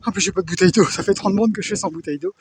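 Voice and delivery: panting after running over rocks; breathless and worn out; out of breath. Real, not acted. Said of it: "je suis au bout de ma vie".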